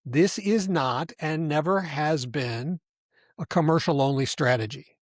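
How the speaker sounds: slow, deliberate emphasis; slow restatement. Real, not acted. Host repeats the point that kills the myth, slowly. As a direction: spell it out slowly, phrase by phrase. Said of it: "I want to say that again slowly".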